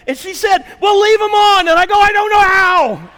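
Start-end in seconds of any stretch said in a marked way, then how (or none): 0.8-1.7 very high, loud shouted order; imitated yelling wife. Wife orders husband about the lights, imitated yelling. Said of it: "So she's yelling at me". 2.0-3.1 high, loud, drawn-out wail; retold helpless yell. Husband at a loss with smart car, retold helpless shouting.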